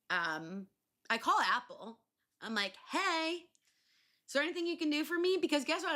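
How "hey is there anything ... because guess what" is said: frantic plea. Retold or imitated. Pleading phone call to Apple.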